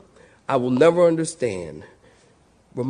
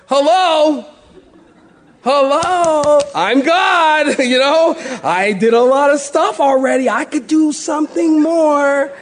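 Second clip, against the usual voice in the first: high, loud, laughing mock call of hello; playfully exasperated, half laughing; Jesus calling out; 13 semitones higher, 7 dB louder than usual. Jesus standing in the back, laughing, calling out to the disciples. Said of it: "Jesus is probably standing in the back going".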